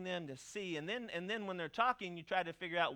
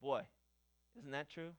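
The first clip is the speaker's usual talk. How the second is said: ordinary delivery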